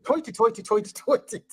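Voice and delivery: put-on joking fighting-Irish accent with a laugh; mock Irish accent. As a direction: put on a hammed-up Irish brogue. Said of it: "fighting Irish accent".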